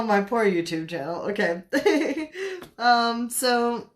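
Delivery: ordinary delivery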